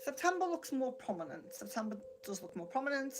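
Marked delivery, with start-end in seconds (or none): none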